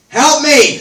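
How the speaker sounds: shrieked high and loud for help; mock cry for help. Comic to the crowd, shrieking a mock plea for help. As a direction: beg the crowd, laughing, at full volume.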